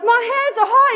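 very high, loud mock cry; amazed exclamation. Pianist telling the kid of rising heat, amazed exclamation. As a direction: yell it in acted astonishment. Healed man exclaiming, escalating.

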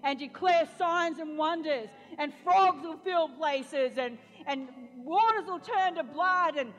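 loud, slow, rising sermon climax; fiery preaching. Acted. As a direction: preach it at full force, drawing it out. Preaching at full force over music.

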